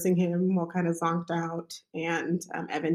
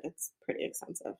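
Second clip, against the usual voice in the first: whisper; 13 dB quieter than usual. Retold or imitated. Midwife quietly warning about tear.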